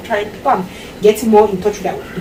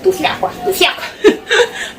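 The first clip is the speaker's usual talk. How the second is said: mock disgusted rejection; 9 semitones higher, slower than usual. Mock-rejects the idea of apologizing.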